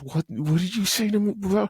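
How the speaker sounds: high, outraged mock snap at the jester; furious king. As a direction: act the king losing his mind. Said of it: "He loses his mind".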